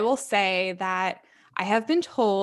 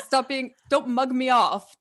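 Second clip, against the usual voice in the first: mock British accent; 5 semitones higher than usual. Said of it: "I now speak in an accent to Rob".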